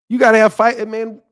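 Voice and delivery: ordinary delivery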